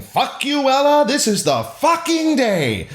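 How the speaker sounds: high, gleeful scream with long last words. A narrator's reading. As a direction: scream it high, stretching the finish.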